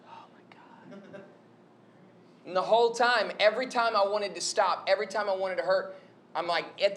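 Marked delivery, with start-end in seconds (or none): 0.1-1.2 slow, voiceless whisper of mock despair; barely audible, hammed-up whisper; whispered dread. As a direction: whisper it weakly, as if all spent. Retells exhausted dread at command.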